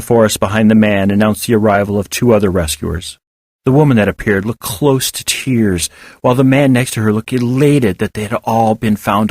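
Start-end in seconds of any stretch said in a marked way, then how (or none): none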